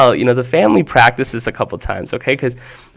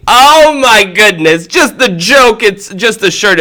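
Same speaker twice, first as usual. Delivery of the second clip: a high, slow, louder oh of amusement; amused outburst; 8 semitones higher, 3 dB louder, slower than usual. Real, not acted. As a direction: draw out the oh, high and delighted. Host amused by the kid's shirt.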